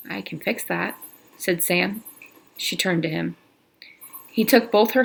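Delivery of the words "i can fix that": quiet, gentle reassurance; soft and tender. Acted. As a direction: reassure her softly. Tender reply.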